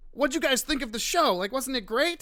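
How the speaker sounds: a lightly voiced eager nerd fishing for praise. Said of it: "some nerd ... was like".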